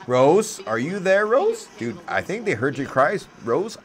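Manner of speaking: high, eager call to a friend; excited calling out. Real, not acted. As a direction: call out with rising hope. Calling Rose about a goal.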